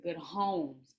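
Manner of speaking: homes with the final S leaned on; stress on the S. Shown as a demonstration. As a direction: push the plural S of homes. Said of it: "Emphasis on the S".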